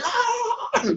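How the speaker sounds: preacher working the crowd up; preacher's shout. Retold or imitated. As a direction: let out a preacher's holler. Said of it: "And he just like".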